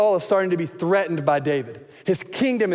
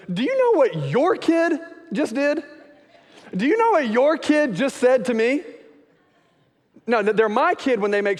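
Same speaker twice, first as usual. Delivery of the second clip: put-on the spouse who blames the other for the kid, pitched way up; exasperated spouse; 6 semitones higher than usual. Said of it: "sounded something like this".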